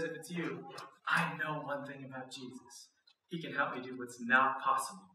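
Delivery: soft earnest Peter. Acted. Peter's request retold softly.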